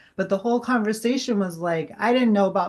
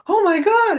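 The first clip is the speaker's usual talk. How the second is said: much higher and louder, a stunned burst of awe; excited gasp; 7 dB louder than usual. Retold or imitated. Medium retelling her shock to the grieving daughter, excited. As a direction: blurt it out in shock. Realizing the dead father spoke.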